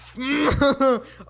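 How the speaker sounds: high laugh, almost crying; cracking up. Real, not acted. Failing to copy the coach's R, cracking up.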